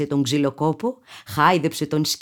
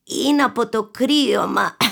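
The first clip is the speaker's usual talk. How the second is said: mumbling wolf; 5 semitones higher than usual. A narrator's reading. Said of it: "ψέλισε ο Λύκος".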